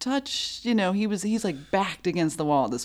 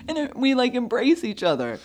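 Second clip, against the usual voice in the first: ordinary talk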